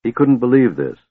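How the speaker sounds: ordinary delivery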